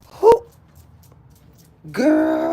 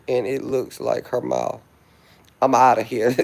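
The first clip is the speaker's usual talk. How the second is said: ordinary talk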